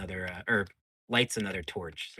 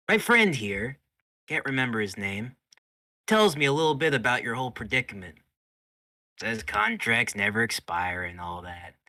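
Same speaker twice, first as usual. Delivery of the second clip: loud, raised voice with dips; theatrical interrogator; 4 semitones higher, 9 dB louder than usual. Acted. Myrna questioning the strapped-up rat, loud and theatrical. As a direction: address the captive with showy confidence.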